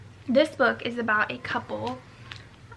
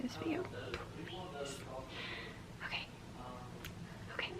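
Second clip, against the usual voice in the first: whisper; 11 semitones lower, 17 dB quieter than usual. Real, not acted.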